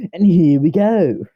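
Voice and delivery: loud, high, drawn-out kickoff; showy announcement. Pretend. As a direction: belt it out slowly like a big show opener. Showy restart, then said plainly.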